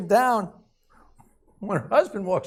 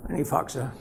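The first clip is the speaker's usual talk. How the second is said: normal talking voice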